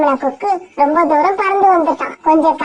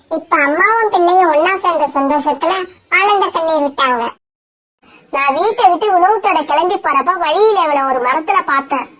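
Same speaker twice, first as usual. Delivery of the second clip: ordinary delivery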